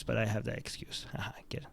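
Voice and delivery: unvoiced whisper with a giggle; whispered joke. Real, not acted. Joking aside about his excuse.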